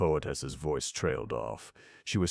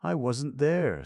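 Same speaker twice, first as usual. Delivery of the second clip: absent; 10 semitones higher, 3 dB louder, slower than usual. Acted. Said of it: "said Eve absently".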